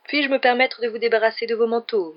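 rehearsed, over-polite little host; affected false politeness. A narrator's reading. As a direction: put on phoney mannered courtesy. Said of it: "d'une voix fausse et maniérée".

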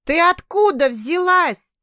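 high, loud, slow surprised question; excited shout. Acted. Daughter surprised to see her mother, excited shout. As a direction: burst out in glad surprise.

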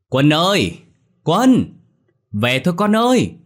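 tiếng gọi to vọng xa; gọi con thật to, cao giọng; gọi lớn. A narrator's reading. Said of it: "Chị quay lại rồi gọi lớn". (gọi lớn tìm con)

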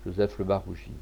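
ordinary delivery, nothing marked

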